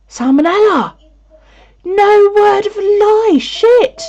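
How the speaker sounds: high, loud startled cry, slowed on no word of a lie; reacting to a viewer's salmonella news with a shocked yell; shocked outburst. Real, not acted. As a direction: yelp it in disbelief.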